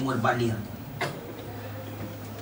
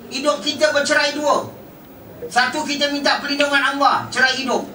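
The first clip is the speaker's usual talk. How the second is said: raised, fervent preaching, much higher and a little louder; preaching at full force; 10 semitones higher, 3 dB louder than usual. Acted. Preacher warning the congregation about the grave, fervent full-force preaching. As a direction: drive the warning home to the crowd.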